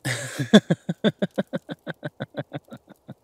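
rolling laughter, slowly tailing off; hearty laugh. Acted. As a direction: laugh out loud and let it die away. Amused by partner's unheard reply.

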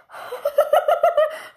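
high, loud nervous giggle; real scared giggle; nervous laugh. Real, not acted. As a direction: giggle through the fear. Nervous laugh before eating.

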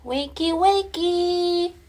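high, loud, slow wake-up call; sing-song wake-up. Real, not acted. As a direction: rouse the sleepy dog. Waking pet.

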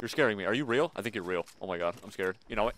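ordinary talk, nothing marked

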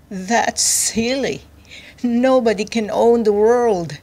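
amused scoff. A narrator's reading. Captive king laughing off the boast, amused. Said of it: "The king of cucumbers laughed".

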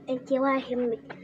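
usual speaking voice

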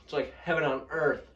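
savoring food; talking while chewing. Real, not acted. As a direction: mouth partly full. Seen 0: Left speaker ate chip at -5, jaw working while talking.

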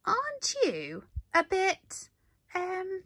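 high, curious squeaky question; a small bee eyeing something far too big; clever little bee asking. A narrator's reading. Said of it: "asked Amelia".

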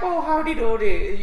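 high, slow cartoon Irish lilt; mock Irish accent. Comic showing panel which accents still pass, mock Irish accent. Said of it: "you know, that's Irish".

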